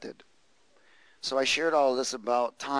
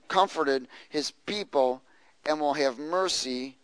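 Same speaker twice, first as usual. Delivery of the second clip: usual speaking voice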